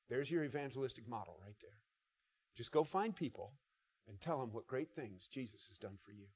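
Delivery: normal talking voice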